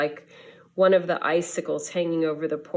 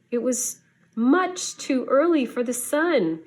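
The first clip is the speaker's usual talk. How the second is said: much higher and louder, full of awe; breathless wonder; 7 semitones higher, 3 dB louder than usual. A narrator's reading. Storyteller revealing Santa's approaching glow, high excited awe. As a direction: point at the sky like a child seeing magic.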